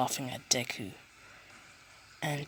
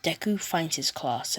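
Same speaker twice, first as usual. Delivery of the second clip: plain reading voice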